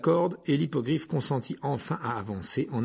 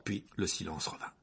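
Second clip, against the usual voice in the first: ordinary delivery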